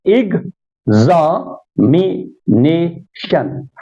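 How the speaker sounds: "examination" very slowly in five separate beats; syllable by syllable. Shown as a demonstration. Teacher counting syllables for students, each one drawn apart. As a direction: break "examination" into ex-a-mi-na-tion. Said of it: "five syllables".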